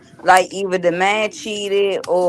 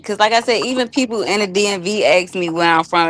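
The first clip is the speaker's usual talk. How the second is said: normal talking voice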